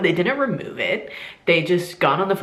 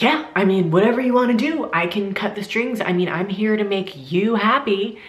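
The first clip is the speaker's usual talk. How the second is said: higher, pointedly put-upon offer to help; mock accommodating doctor; 5 semitones higher, 3 dB louder than usual. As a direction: imitate an exasperated doctor giving in. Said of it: "and said it like that".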